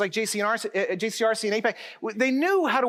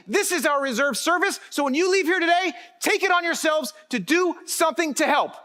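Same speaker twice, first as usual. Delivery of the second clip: a rising rally cry, higher and building; rousing call to action; 6 semitones higher than usual. Acted. Speaker urges the community to act after Shloshim, rousing rally speech. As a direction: fire up the crowd to go out and act.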